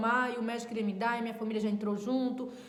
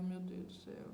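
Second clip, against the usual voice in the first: dismayed murmur; 2 semitones lower, 9 dB quieter than usual. Retold or imitated. Frightened reaction to bad news.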